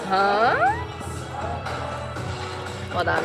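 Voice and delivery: usual speaking voice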